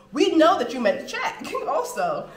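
high and loud, breaking into a laugh. Real, not acted. Laughing as she explains the check to the waiter. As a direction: laugh it out at the end.

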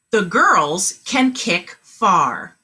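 each word slow and clear; slow model sentence. Shown as a demonstration. Teacher modeling a sentence for kindergartners, slow and clear. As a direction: model it slowly for the class. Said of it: "Your turn".

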